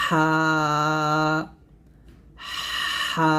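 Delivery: হা with a long rush of air from mid-throat, then a held vowel; long, steady, airy-onset হা; breathy throat H. Shown as a demonstration. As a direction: push air through the throat before opening into হা. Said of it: "আমার সাথে আপনারা উচ্চারণ করেন".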